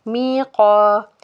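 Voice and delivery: मी का with both vowels held evenly; मी का, slow recited long ee and long aa; stretched long vowels. Shown as a demonstration. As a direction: draw out मी and का slowly, one alif each. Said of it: "एक एक अलिफ के बराबर खींच के पढ़ेंगे".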